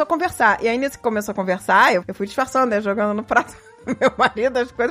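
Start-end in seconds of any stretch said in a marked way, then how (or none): none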